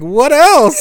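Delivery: high and loud, bursting with eager curiosity; a server thrilled to quiz a guest; excited. Retold or imitated. Server eagerly asking what else is in the drink, excited. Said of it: "She was so excited".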